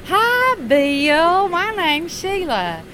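very high, loud sugary greeting; bubbly Sheila. Retold or imitated. Sheila repeating her greeting to the engineer, high and syrupy. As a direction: stretch it out high and sweet. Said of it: "And she goes".

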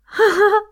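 short bright laugh; happy high laugh. Shown as a demonstration. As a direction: squeal of delight. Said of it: "three sounds of happiness".

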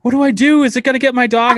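high, loud mock panic; hammed-up frantic questioning; imitated freak-out. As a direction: play a panicked caller begging for help. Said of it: "she starts freaking out".

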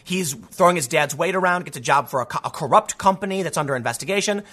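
ordinary talk

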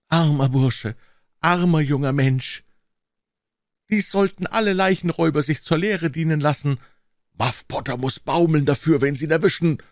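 high-pitched crowd voices, pitying then admonishing; murmuring bystanders. A narrator's reading. Onlookers at a murder scene commenting on the culprit, high-pitched gossip.

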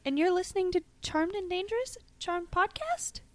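hammed-up deja vu confusion; mock bewildered. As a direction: say the intro as if it feels strangely familiar. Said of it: "We're not actresses".

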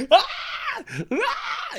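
high-pitched imitated screams; hammed-up squeals; mock kid scream. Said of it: "like, screaming".

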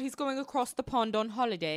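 understated mock British lilt; mock British accent. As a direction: put on a light British accent.

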